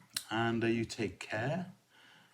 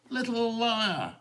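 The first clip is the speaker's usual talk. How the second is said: high, slow sing-song taunt; mocking crowd; 13 semitones higher, slower than usual. A narrator's reading. Crowd answering Matilda's cries, high slow mocking taunt. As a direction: jeer it like a scornful crowd. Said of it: "they only answered".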